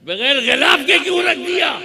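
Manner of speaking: high, loud pulpit voice building the story; fiery preaching. Acted. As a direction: preach it to the crowd at full force. Son's question, preached.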